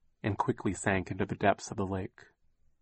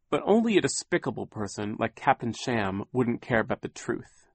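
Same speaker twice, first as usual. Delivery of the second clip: usual speaking voice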